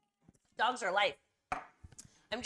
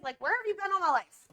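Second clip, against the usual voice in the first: excited gush; 7 semitones higher, 5 dB louder than usual. Real, not acted. Excited over friend's condo, horses.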